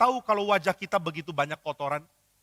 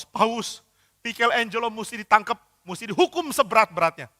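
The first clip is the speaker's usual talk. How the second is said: angry complaint; 5 semitones higher, 3 dB louder than usual. Acted. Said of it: "Dia marah, dia lapor ke Paus".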